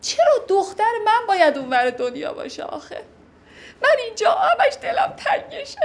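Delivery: high, whining complaint with a laugh; self-pitying whine.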